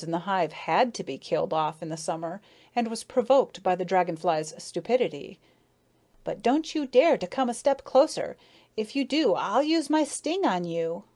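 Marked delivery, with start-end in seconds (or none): 6.2-11.0 higher-pitched, indignant threat; heated, high-pitched defiance; angry warning. A narrator's reading. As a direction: warn off an attacker, temper lost. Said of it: "had really lost her temper".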